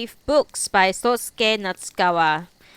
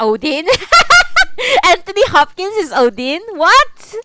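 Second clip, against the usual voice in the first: louder and higher, rising in disbelief; can't believe what chat just told her; startled disbelief; 9 semitones higher, 10 dB louder than usual. Real, not acted. Reads chat claim about Odin, loud startled disbelief.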